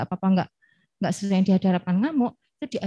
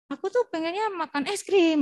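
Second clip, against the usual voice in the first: put-on child voice; 9 semitones higher than usual. Child's voice quoted.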